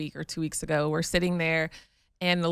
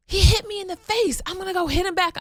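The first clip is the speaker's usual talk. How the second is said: put-on high, loud, upset kid voice, much higher; imitating a furious twelve-year-old demanding payback; angry son; 12 semitones higher, 4 dB louder than usual. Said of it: "He was like".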